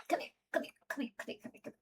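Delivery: soft breathy whisper to the cat; whispered coaxing. Real, not acted. Coaxing the cat closer in a whisper. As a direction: whisper to lure her over.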